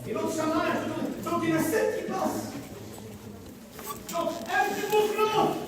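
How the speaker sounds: ordinary delivery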